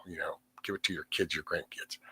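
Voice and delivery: quick whispered afterthought; whispered aside. Real, not acted. Streamer telling viewers to save money, whispered aside. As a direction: drop to a whisper, a bit hurried.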